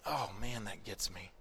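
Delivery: ordinary delivery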